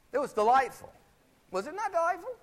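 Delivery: high-pitched, gushing delight; delighted insistence. Real, not acted. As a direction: press the audience to agree, voice way up high. Raving to audience after the demonstration.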